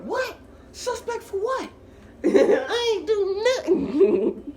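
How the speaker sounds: very high, loud squealed disbelief; incredulous protest. Retold or imitated. Suspect protesting to the cops who grabbed him, shrill disbelief. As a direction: protest in a squeal, building to a drawn-out yell.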